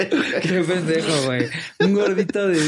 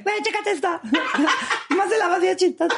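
squeaky helium voice, very high. Retold or imitated. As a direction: talk like you just sucked a balloon. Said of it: "El que dice". (voz de helio chillona)

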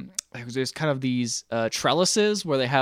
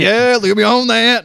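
mock Maria; 9 semitones higher, 7 dB louder than usual. Voicing Julie Andrews.

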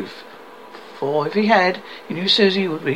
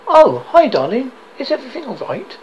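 surprised phone greeting; 8 semitones higher, 6 dB louder than usual. Acted. Answering phone to wife.

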